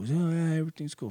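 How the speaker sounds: high mocking imitation. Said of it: "and he was cool".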